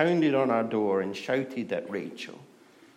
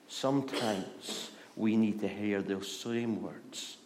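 normal talking voice

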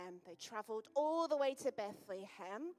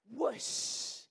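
whooshing wind sound; 4 dB louder than usual. Acted. Angel flies in, story effect.

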